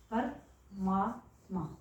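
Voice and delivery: परमात्मा stretched out very slowly, syllable by syllable; slow, drawn-out परमात्मा. Shown as a demonstration. Teacher to students showing the joined word, spelling परमात्मा out slowly. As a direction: draw out परमात्मा slowly so students hear each part. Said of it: "अब आपको इसको ध्यान से देखना है".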